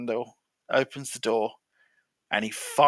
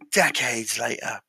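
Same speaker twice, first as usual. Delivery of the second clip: drawn-out storytelling; 3 semitones lower, slower than usual. A narrator's reading. Storyteller jumps ahead in the monk joke, slow and drawn out. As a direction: stretch each word slowly. Storyteller marks a big time jump.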